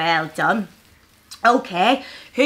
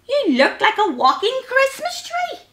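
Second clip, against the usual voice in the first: high-pitched fox character voice; lively animal voice for children; startled fox; 14 semitones higher, 5 dB louder than usual. A narrator's reading. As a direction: exclaim it high in playful surprise. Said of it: "yelped Fox".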